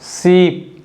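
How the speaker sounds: शीप said with a drawn-out long ई; शीप stretched on the ee sound; long ee in sheep. Shown as a demonstration. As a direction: hold the vowel in शीप long. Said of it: "शीप का मतलब होता है भेड़".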